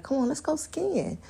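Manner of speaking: high, pushy invitation to go skiing; eager urging. Pretend. Urging her man to go skiing, pitched high and pushy. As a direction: talk him into it before he can refuse. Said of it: "I be like".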